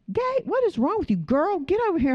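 high, urgent call to a friend; a friend's high, bossy alarm, pulling her close; concerned friend. Pretend. Imagined friend calling her out.

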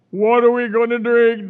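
lightly voiced grumbling crowd; demanding complainers. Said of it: "they demanded".

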